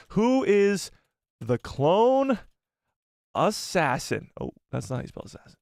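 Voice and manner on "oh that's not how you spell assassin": soft, fast aside to self; muttered. Real, not acted. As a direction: mumble it under your breath. Muttering to self while typing.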